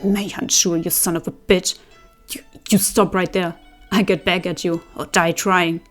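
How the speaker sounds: loud furious shouting after him; furious yelling. A narrator's reading. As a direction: hurl it at his back in a rage. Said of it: "hop around in fury".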